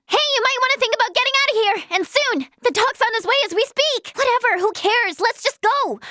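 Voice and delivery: high, hurried heads-up; pressing, high-pitched urging; urgent warning. Acted. Warning the others to get out.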